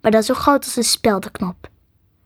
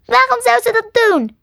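high, alarmed small-animal squeak; tiny high-pitched creature voice; squeaking squirrel; 13 semitones higher, 4 dB louder than usual. A narrator's reading. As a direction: squeak the question in fright. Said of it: "piepte het diertje".

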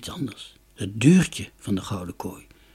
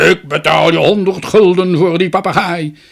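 louder, pompous rich man's offer; loud call; 6 semitones higher, 10 dB louder than usual. A narrator's reading. Fat rich man outbids the children, loud and pompous. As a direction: boom out the offer. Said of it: "riep de dikke rijke meneer".

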